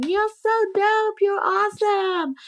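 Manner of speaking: mock gushing backstage praise; imitating crew praise. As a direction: hype her up like excited crew.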